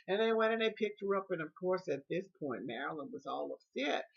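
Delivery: usual speaking voice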